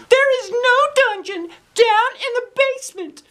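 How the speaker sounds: very high, loud insistent voice; insist, shutting the idea down. Acted. Girl insists to the boys there's no dungeon. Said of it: "Emily insisted".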